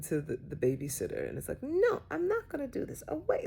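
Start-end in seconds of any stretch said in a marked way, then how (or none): 1.6-3.5 high, mock-bratty child protest; mock tantrum, pitched up high; imitating a rude yell. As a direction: play the child snapping at the babysitter, hammed up. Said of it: "yelling and being, like, really rude".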